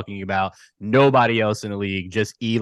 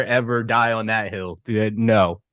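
ordinary talk

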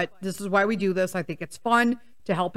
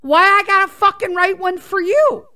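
high-pitched rant bursting louder at the end; outraged, pitched up, building to a loud protest; indignant comeback; 7 semitones higher, 5 dB louder than usual. Retold or imitated. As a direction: fire back at the husband in exasperation. Angry comeback to the husband.

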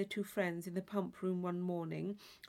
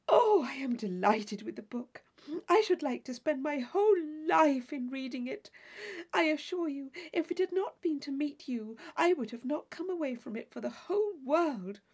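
put-on higher, louder rapturous young reader; higher eager voice; delighted Catherine; 6 semitones higher, 4 dB louder than usual. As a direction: gush about the book.

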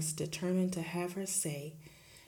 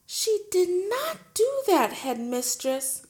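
much higher, louder protest; indignant protest; 13 semitones higher, 10 dB louder than usual. Acted. Teacher defending Matilda to the headmistress, much higher. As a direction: defend the child. Miss Honey voiced in story.

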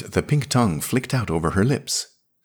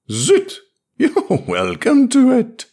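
loud, much higher, slow laughing sneer; drunken sneer; 6 dB louder, slower than usual. A narrator's reading. Drunk Drylander sneering as he turns away.

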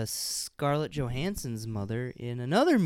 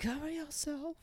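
1 mock scold; 11 semitones higher, 11 dB quieter than usual. Mock parent command.

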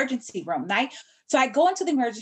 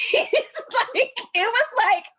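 high laughing talk; 13 semitones higher, 3 dB louder than usual. Real, not acted. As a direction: laugh while telling it. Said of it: "It's funny now".

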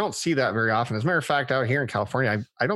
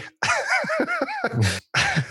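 burst of laughter. Real, not acted. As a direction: crack up laughing. Laughing at his own reading.

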